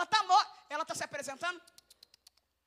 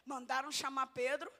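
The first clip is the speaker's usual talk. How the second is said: ordinary delivery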